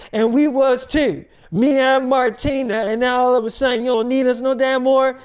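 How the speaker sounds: heated mock tirade; retold scolding. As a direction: scold her forcefully, imitating an indignant sister. Retold sister scolding in a quarrel.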